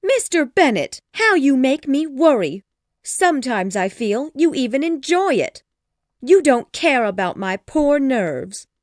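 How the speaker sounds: high, loud, overwrought wife; hammed-up, nervy Mrs. Bennet; distressed, complaining wife. A narrator's reading. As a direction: wail dramatically at your husband.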